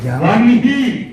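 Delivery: వన్ హీ slow, న before హ, syllable by syllable; careful pronunciation. Shown as a demonstration. Teacher showing pupils how to say వన్ హీ, slow and careful. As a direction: draw out వన్ హీ slowly. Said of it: "వన్ హీ అనాలి".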